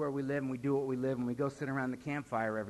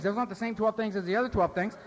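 ordinary talk

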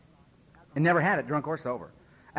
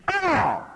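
sharp high yelp into the mic; a piercing, very loud cry; loud shout; 8 dB louder than usual. Retold or imitated. Sponsor yelling into the microphone to get attention.

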